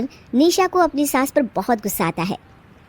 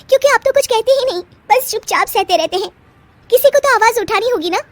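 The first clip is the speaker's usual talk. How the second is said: much higher, fast complaint; a young bride's much higher voice, fast and exasperated; exasperated young wife; 11 semitones higher, 3 dB louder than usual. Acted. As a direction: scold him for staying silent. Bride chides husband.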